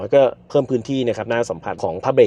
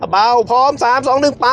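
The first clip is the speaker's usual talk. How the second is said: excited countdown; 11 semitones higher than usual. Real, not acted. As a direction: call the countdown with rising thrill. Overtake countdown. (นับถอยหลังอย่างตื่นเต้น)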